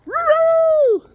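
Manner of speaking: high, loud sing-song "Roo-roo"; high chicken call. Real, not acted. Calling the chickens in.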